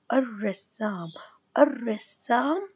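الرسام very slowly, no l, doubled r; slow sun lam. Shown as a demonstration. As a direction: arrassam drawn out. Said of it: "اسمعوا شو حكيت".

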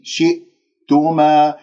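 شئتما slowly, with a silent stop after shi; still hamza. Shown as a demonstration. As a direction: close the throat cleanly in شئتما. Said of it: "والهمزة ساكنة". (همزة ساكنة في شئتما)

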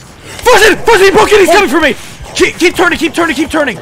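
high, loud frantic calling; panicked shouting. Real, not acted. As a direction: yell for help while chased. Chased by killer, calling out.

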